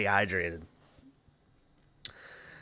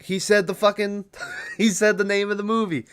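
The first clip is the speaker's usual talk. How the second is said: excited laughing; 9 semitones higher than usual. Real, not acted. Telling viewers about the movie, laughing in excited disbelief. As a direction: pitch jumps high, laughing in disbelief. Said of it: "And I was like".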